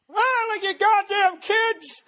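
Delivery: put-on very high, slow, cranky old codger voice; old man. Griping about virtual shopping, slow cranky old man voice. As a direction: croak it out like a grumpy old geezer. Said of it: "Sound like a fucking old man".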